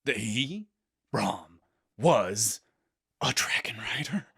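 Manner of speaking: halting build into a stage whisper; hammed-up suspense, ending in a hush; mock-dramatic reveal. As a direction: pause before each piece, then whisper the twist.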